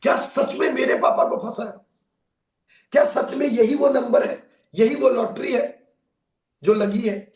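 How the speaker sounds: checking the numbers, barely holding in joy; giddy son laughing in disbelief. Acted. Son checking the winning ticket.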